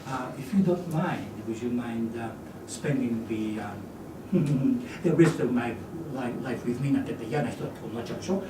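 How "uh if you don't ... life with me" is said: slow, halting, with uh pauses and a nervous laugh; hesitant slow proposal. Shown as a demonstration. Teacher mocking a timid suitor asking to marry, slow and hesitant. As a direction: dither over every word. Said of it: "だからゆっくり考えながら".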